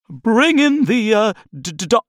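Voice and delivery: squeaky nervous assistant stammering. Acted. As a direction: trip over the words, flustered. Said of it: "a technician began to say".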